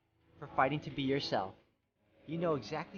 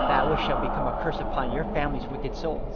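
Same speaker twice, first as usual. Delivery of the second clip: booming curse; 8 dB louder than usual. Acted. Morgana pronounces a curse.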